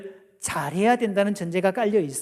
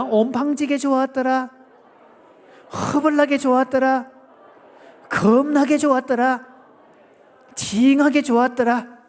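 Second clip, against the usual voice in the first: high, emphatic Jeolla chant; Jeolla dialect; 4 semitones higher, 4 dB louder than usual. Shown as a demonstration. Preacher demonstrates four Jeolla versions, high emphatic Jeolla dialect. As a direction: belt each phrase out in Jeolla dialect. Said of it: "전라도 버전입니다".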